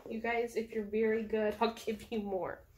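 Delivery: plain reading voice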